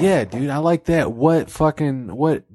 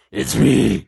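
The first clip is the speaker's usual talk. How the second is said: rough Batman-like growl; demonic God; 3 semitones lower than usual. Acted. God announces himself, demonic growl. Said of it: "some demonic".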